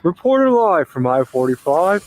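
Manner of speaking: slow, lightly hammed-up on-the-scene reporter; playful TV reporter sign-on; mock news reporter. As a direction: pretend to open a live news report. Plays local TV news reporter.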